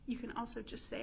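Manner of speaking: ordinary talk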